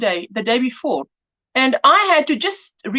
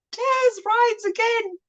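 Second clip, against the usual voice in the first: higher and slow, laughing through Taz Rides Again; 10 semitones higher, 3 dB quieter, slower than usual. Real, not acted. Amused at her own book title, repeating it laughing. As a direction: savour the title while giggling.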